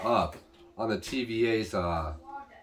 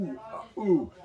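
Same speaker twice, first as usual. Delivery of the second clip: excited ooh; 5 semitones higher, slower than usual. Retold or imitated. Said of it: "he's getting all excited over the fight".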